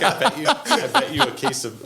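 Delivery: laughing as you mimic the drunk guy; laughing mock drunk. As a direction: squeal the bet while cracking up. Voicing the drunk guy's bet.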